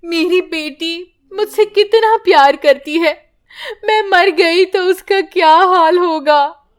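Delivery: weeping mother praying alone; crying prayer. Acted. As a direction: sob out the prayer. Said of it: "रोने लगती है".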